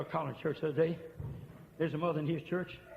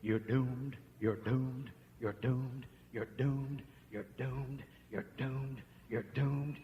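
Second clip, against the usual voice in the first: softer, low repeated chant; devil's taunt; 3 semitones lower, 6 dB quieter, much slower than usual. Retold or imitated. Preacher voicing the devil taunting the man, softly. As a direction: murmur doom over and over. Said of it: "what the devil said to him".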